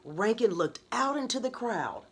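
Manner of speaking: ordinary delivery, nothing marked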